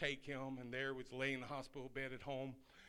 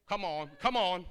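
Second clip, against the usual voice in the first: loud rallying call; loud come-on to the crowd; 5 semitones higher, 12 dB louder than usual. Real, not acted. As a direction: urge the saints on. Calling out to congregation.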